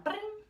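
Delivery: high mock phone ring; imitated phone ring. Calling her boyfriend, a high imitated phone ring. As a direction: chirp it like a ringing phone.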